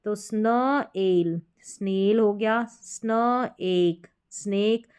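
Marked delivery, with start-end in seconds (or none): 0.2-2.2 स्न, pause, एल, then joined as स्नेल; blending by parts. Shown as a demonstration. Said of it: "इसको स्न पढ़ेंगे". 2.6-4.9 स्न, pause, एक, then joined as स्नेक; very slowly "snuh... ake", then स्नेक whole; blending by parts. Shown as a demonstration. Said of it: "इसको स्न पढ़ेंगे".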